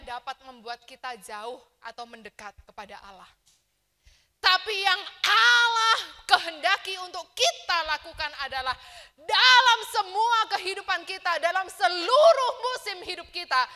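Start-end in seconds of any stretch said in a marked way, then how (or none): 4.4-13.6 much louder and higher, proclaiming; preaching at full force. Acted. Pastor urging the congregation to abide in God, full-force preaching. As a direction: drive the final point home to the church. Climax of sermon.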